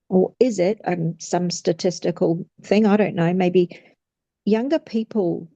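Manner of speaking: ordinary talk, nothing marked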